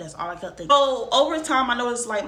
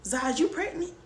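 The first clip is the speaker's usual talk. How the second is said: mom asking; 7 semitones higher than usual. Retold or imitated. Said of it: "And she said".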